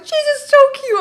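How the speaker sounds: very high, loud squeal; squealing drunk mom. Retold or imitated. Drunk mom playing with Jason's hair, very high loud squeal. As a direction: shriek it like a smitten tipsy mom.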